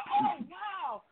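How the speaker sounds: high, bright fake wow; mock surprise. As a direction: sound falsely amazed. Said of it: "So I said".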